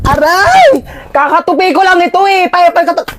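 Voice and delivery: whiny angry yelps. Acted. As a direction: yelp and whine like a bratty sibling. Sibling squabble over folded clothes.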